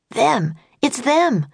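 Jack, high and urgent, spotting them; sudden alarmed recognition, pitched high; excited boy. A narrator's reading. Jack spots the two enchanters in the snow, alarmed. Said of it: "said Jack".

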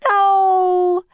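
high, loud drawn-out scream. A narrator's reading. Annie stopping the captain's attack, high scream. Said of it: "screamed Annie".

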